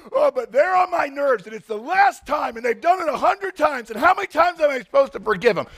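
high, exasperated whining protest; whiny complaint. Acted. Pastor voicing a fed-up listener, high exasperated whining. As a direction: whine like you're fed up.

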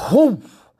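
loud, high voiced whoosh of a fall; sudden storybook "whoof"; tumbling whoof. A narrator's reading. Owl tumbles down the snowbank.

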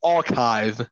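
low, British-accented word; put-on British pronunciation. Shown as a demonstration. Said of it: "say it in a British way".

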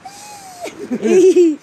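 very high falsetto squeak; high-voice squeal. Shown as a demonstration. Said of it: "That's my high voice".

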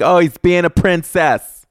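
high, drawn-out mimicry of complainers; mock the accusation in a high voice; mocking critics. Voicing critics' imagined complaint.

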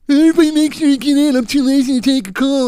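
high, put-on whiny coworker voice; mocking lazy coworker. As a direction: mimic her lazy call-out in a squeaky voice.

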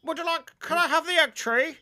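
high, loud mock Partridge voice; a deliberately poor Partridge impression; bad Alan Partridge impression. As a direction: ham up a Partridge voice you can't do. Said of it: "one impression you can't do".

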